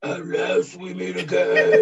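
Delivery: raspy villain voice, breaking into a laugh; booming echoing villain monologue. Shown as a demonstration. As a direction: growl it like a cartoon villain, fighting a laugh. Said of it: "And raspier".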